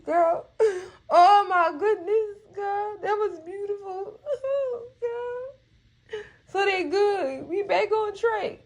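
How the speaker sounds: high, tearful laugh-cry; real crying. Real, not acted. Fan overcome by the brothers making up, crying. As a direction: squeeze the words out high through real tears. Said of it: "I'm about to cry again".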